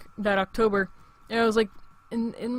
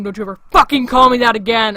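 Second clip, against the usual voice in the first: retold yell, much louder; 10 dB louder than usual. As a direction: bark it like you mean it, a retold yell. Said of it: "I had to yell at that kid".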